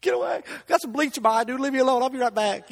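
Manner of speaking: high, loud, choked-up excuse; teary brush-off to a customer about bleach in the eye; retold teary brush-off. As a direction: wave him off while fighting tears. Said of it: "so I'm crying".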